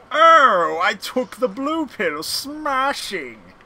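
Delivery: loud, high, swooping British cartoon voice; over-the-top British exclaiming; Nigel Thornberry. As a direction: ham it up as an eccentric British cartoon character. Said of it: "So Morpheus and Nigel Thornberry teaming up".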